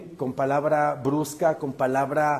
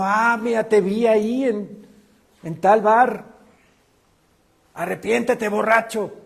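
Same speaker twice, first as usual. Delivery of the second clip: higher, louder accusing reproach; legalistic scolding; 6 semitones higher, 5 dB louder than usual. Acted. Preacher mimics a legalist catching a brother at the bar, scolding. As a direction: confront them like a self-righteous accuser. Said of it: "no llego yo con legalismo".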